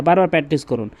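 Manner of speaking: usual speaking voice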